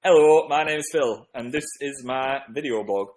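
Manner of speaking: put-on northern English accent. Shown as a demonstration. Vlogger doing requested accent for fans, northern English accent. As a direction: introduce yourself in broad northern English. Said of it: "Do a northern accent".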